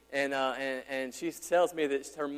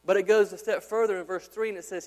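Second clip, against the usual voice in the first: ordinary talk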